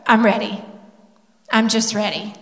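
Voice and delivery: low, soft retold admission; quiet resolve. Son handing himself over for baptism, quietly resolved. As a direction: imitate a moved son giving in softly.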